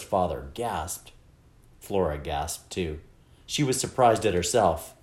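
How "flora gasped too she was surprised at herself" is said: ordinary delivery, nothing marked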